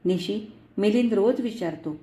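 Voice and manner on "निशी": usual speaking voice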